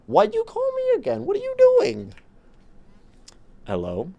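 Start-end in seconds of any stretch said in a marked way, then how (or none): 0.1-2.1 very high squeaky voice, scolding the phone; high cartoonish scold at a spam caller. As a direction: squeak at the caller in mock annoyance.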